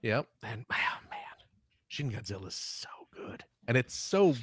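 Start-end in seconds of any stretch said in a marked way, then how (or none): 0.4-3.4 whispering. Real, not acted. Aside about Shin Godzilla.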